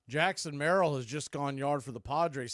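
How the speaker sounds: ordinary delivery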